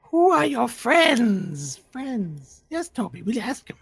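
high, slow, drawn-out questions; creepy voice from the dark; eerie creature voice. Acted.

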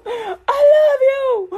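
very high, very slow crying voice; mock tearful love. Worshipper and Jesus trade love, mock crying. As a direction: stretch it out in a hammed-up sob.

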